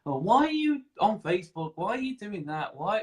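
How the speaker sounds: high-pitched, nagging imitation of disapproving villagers; mock disapproving relative, pitched right up; small-minded busybody. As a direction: question it like a scandalized village gossip. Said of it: "There's people that have".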